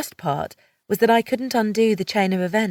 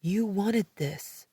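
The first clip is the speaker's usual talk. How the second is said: softer, slow and subdued; quietly; 5 dB quieter, slower than usual. A narrator's reading. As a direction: say it quietly after a silence. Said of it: "he said quietly".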